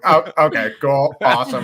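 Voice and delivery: sarcastic "great, just great" energy; mock approval. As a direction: retell it with fake enthusiasm, half laughing. Retold sarcasm after turtle was lost.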